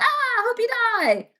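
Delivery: high, slow, hammed-up yell; drawn-out greeting shout. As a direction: stretch the Ah into a big mock-friendly hail. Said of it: "they'd see me in the hallway and be like".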